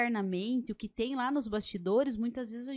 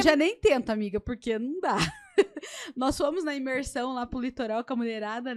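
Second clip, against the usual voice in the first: laughing; 2 semitones higher, 7 dB louder than usual. Real, not acted. Laughing while starting a story.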